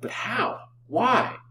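hushed first question, then high cry; astonished. A narrator's reading. Lover hears the dead girl lives, astonished. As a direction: gasp the question, then let it leap up. Stunned by claim she lives.

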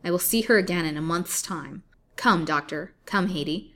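loud, commanding menace; loud villainous command; menacing threat. Acted. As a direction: lay down the sentence coldly. Colville threatens the captive.

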